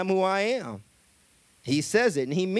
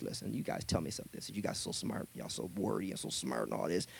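much lower and softer, teasing; low, soft mocking of the Pharisees; low mocking Jesus voice; 11 semitones lower, 12 dB quieter, faster than usual. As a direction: needle the know-it-alls quietly.

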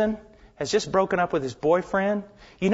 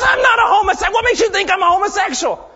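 high, loud, outraged shout; boy shouting his denial at the preacher, much higher and louder; furious denial; 9 dB louder, faster than usual. Retold or imitated. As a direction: hurl the denial back. Boy denies angrily.

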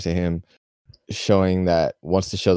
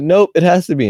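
higher and louder, insisting; mock insistent; 11 semitones higher, 6 dB louder than usual. Villagers insisting on the capital name, mock insistence. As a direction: shut down the objection. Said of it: "They go".

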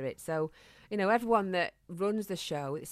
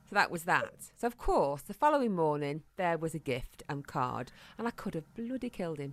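usual speaking voice

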